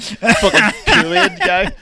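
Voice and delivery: loud, slow booming cry; Kool-Aid Man yell. Retold or imitated.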